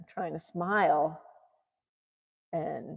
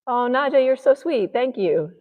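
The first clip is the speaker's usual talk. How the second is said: high, louder sweet voice; cooing at Naja; 8 semitones higher, 5 dB louder than usual. Real, not acted. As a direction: coo thanks to a pet.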